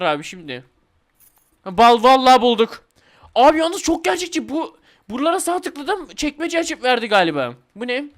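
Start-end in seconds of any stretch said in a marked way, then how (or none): 1.6-6.8 loud, high burst of joy; thrilled gamer crowing over finding the key; excited find. Real, not acted. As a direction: shout it out at the find.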